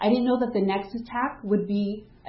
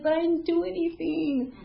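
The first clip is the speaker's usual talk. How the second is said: small, much higher retold plea; high pleading protest; 8 semitones higher, 3 dB quieter than usual. Accused worker answering the policeman, high pleading protest.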